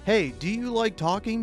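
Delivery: ordinary talk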